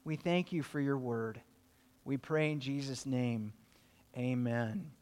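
soft, hushed prayer voice, a little quick; hushed closing prayer after the study; quiet prayer. Real, not acted. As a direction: pray it gently and close.

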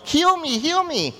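crying out. Retold or imitated. Leper begging Jesus to heal him, high pleading cry. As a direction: plead like a desperate man calling out. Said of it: "He cries out to Jesus".

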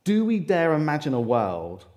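ordinary delivery

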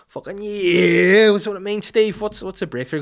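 high, loud whoop easing into eager questions; hammed-up excitement. Boss hearing of a case breakthrough, exaggerated excitement.

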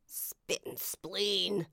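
slow, drawn-out swearing; muted curse. A narrator's reading. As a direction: swear through frustration. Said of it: "Usagi swore".

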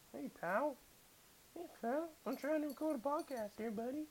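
high, very soft pet voice; talking to the dog. Real, not acted. To his dog mid-recording, soft high pet talk.

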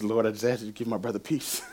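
low, soft murmured prayer; quiet prayer. Retold or imitated. Friend praying for his crying brother, quiet and low. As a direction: pray gently under your breath for a friend.

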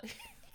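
squeaky burst of laughter; high laugh. Real, not acted. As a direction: break into a high giggle. Laughs at own voice.

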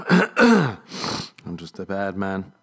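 very slow, hammed-up confession; mock-dramatic confession. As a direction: drag out the words like a melodramatic villain.

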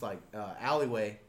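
ordinary delivery

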